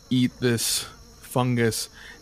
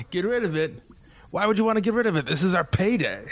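much higher, incredulous thug voice; scoffing mercenary; 8 semitones higher, faster than usual. Acted. Mercenary mocking the paladin who wants to end the decay. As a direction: scoff at the hero's plan.

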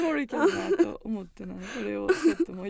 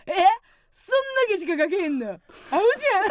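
mocking taunt, sharp rise; 煽り口調; 7 semitones higher, 6 dB louder than usual. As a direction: tease her like a rude coworker. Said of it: "とか言ってきて". (小馬鹿にした口調)

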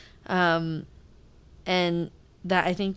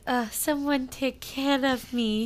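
mock helpless; 6 semitones higher, slower than usual. Mocking Eden playing helpless.

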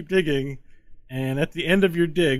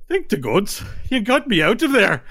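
relieved little man; 8 semitones higher, 6 dB louder than usual. Acted. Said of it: "He's like".

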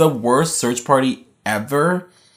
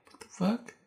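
muttered disbelief; 6 semitones higher, 15 dB quieter than usual. Retold or imitated. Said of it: "she's like".

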